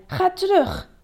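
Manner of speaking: high, sharp command; angry order. A narrator's reading. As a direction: order them back down.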